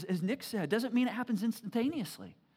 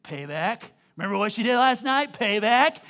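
loud taunting payback; teenage son gloating over his locked-out sister; smug son; 10 dB louder than usual. Retold or imitated. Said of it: "He's like".

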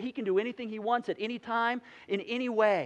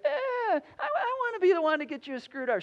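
put-on high, louder child's whine; whiny little girl; 10 semitones higher, 5 dB louder, faster than usual. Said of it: "this whine on her voice".

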